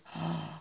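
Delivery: quiet sharp intake of surprise; soft gasp. Real, not acted. Seeing the next gift, gasping softly. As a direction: catch your breath, amazed.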